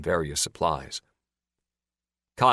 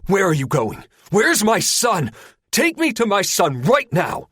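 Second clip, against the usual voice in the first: loud, much higher, demanding; slowing, hammering each word of the demand; shouting; 13 semitones higher, 7 dB louder than usual. Acted. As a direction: yell after him, desperate and furious. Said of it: "Linder shouts".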